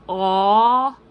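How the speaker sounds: aw said loud with a tall rounded mouth. Shown as a demonstration. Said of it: "I keep it like this".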